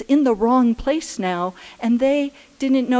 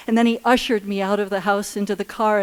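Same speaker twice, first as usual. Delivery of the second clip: ordinary talk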